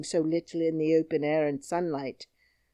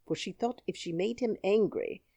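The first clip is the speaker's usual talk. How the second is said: usual speaking voice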